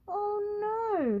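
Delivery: high shocked gasp; a shocked high cry. Acted. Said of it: "he gasped".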